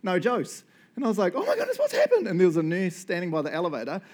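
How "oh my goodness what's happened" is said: high, laughing panic; retold panic, half laughing; panicked. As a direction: freak out that the wife is gone. Wife missing from foyer.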